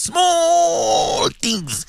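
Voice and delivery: high, breaking cry over small things; anguished outcry. Real, not acted. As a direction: cry out in real dismay. Radio host crying out in dismay.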